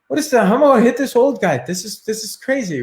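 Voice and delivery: high-pitched disbelieving thoughts; incredulous. Retold or imitated. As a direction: rattle off your baffled disbelief. Inner reaction to fighting old man.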